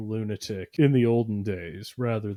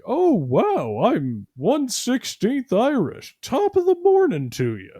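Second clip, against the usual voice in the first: much higher, slow, hammed-up gushing; mock gushing Westerner; 11 semitones higher than usual. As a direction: squeal it like a proud one-sixteenth Irish tourist. Mocking Westerner boasting Irish roots.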